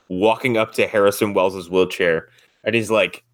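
ordinary talk, nothing marked

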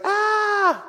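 high squealed scream; imitated child's shriek, very high; little girl's mock scream. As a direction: squeal like a startled toddler. Daughter's scream at monster.

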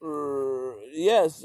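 ordinary talk, nothing marked

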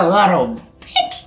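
laughing sneer, drawn out slowly; imp voice. Acted. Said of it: "He goes".

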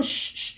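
soft hissed shush. Retold or imitated. Student shushes teacher while lowering hand, soft hiss. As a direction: keep hushing, breath only. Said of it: "le iba haciendo".

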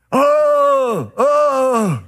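high, pained mock wail of self-pity; wailing groan. Preacher imitating a grumbler's mock wail. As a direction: moan it out like a miserable morning. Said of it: "got up in the morning and said".

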